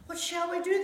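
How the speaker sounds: storyteller's frightened question; crying out. A narrator's reading. Disciples huddled in the boat, crying out in alarm. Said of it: "they cried".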